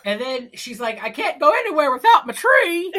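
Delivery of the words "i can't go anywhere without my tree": high, loud mock protest; put-on high-pitched whine; whiny partridge. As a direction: wail it like a melodramatic bird. Acting out the whining partridge.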